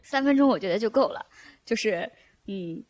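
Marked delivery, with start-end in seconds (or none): none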